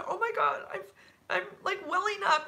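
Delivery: high-pitched, moved retelling of her; welling-up supervisor. Retold or imitated. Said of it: "I actually had her in tears".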